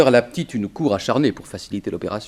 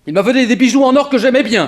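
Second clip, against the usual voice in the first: high, loud aggrieved whine; aggrieved complaint; 12 semitones higher, 9 dB louder than usual. Acted. Robbed man grumbling to the detective, aggrieved. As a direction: whine about his lost gold.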